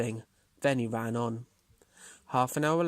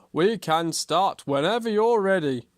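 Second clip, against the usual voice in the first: loud, slightly raised projected voice; announcing bear; 5 semitones higher, 7 dB louder than usual. A narrator's reading. Bear tells the racers to begin, loud and projected. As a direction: call it out to the racers.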